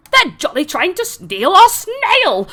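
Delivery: high, slow, posh outraged cry; indignant Harengon. Acted. Harengon rushing over to the party, high and indignant. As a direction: splutter it like an affronted rabbit. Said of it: "the Harengon comes over going".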